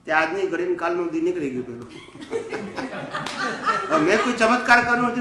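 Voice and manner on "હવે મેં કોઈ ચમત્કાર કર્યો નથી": high laughter spilling into the words; laughing. Real, not acted. Laughing it off as no miracle, laughing. Laughs after exorcism story.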